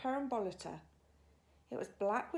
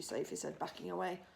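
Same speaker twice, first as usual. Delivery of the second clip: ordinary delivery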